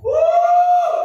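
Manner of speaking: high whoop of joy on a motorbike; joyful whoop. Retold or imitated. Pastor riding home shouts with joy, high whoop. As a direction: let out a high happy cry. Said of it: "Sumisigaw talaga ako".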